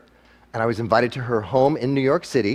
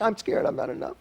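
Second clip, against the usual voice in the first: higher, softer and distant, like an echo returning; 7 semitones higher, 6 dB quieter than usual. Shown as a demonstration. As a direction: let it sound like it bounces back from far away. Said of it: "that's what comes back".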